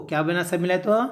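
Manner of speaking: normal talking voice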